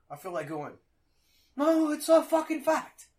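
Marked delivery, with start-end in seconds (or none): 1.6-3.1 acted indignant retort, pitched way up; exasperated outburst. Imagined retort to someone denying facts, exasperated.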